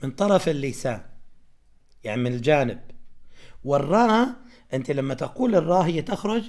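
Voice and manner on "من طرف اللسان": ordinary delivery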